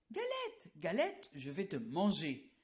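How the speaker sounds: sing-song rabbit call sliding down to a mock threat; rabbit crying out. Rabbit to the galette, a lightly voiced playful threat. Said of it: "crie le lapin".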